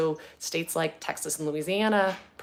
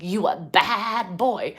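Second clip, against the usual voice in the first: slow, a bit louder little-girl scold, imitating; toddler scolding; 3 semitones higher, 6 dB louder, much slower than usual. Mother re-imitating her toddler scolding a boy, slow. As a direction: look up at a bigger boy and scold him slowly. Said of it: "So she's pointing up, like".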